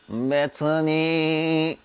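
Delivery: 「別に」 sung into the nose; nasal demo. Shown as a demonstration. Coach showing the nasal resonance on 「別に」. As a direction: put 「別に」 into the nasal cavity. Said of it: "みたいな感じで鼻に声入れてる".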